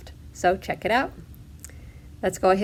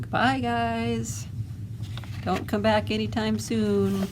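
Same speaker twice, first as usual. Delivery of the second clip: mocking farewell; 3 semitones higher, 5 dB quieter than usual. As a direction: call softly after them in singsong. Voicing a farewell to the villains.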